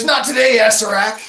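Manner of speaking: high, loud heroic taunt; defiant hero. Acted. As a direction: call it out to stop the villain. Hero defiantly interrupts villain's spell.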